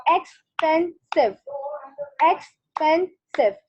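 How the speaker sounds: slow, each syllable of "Expensive." split apart; syllables clapped out. Shown as a demonstration. Teacher clapping out "Expensive." for the class, slow and spaced. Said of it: "notice the clap with the, with the sound".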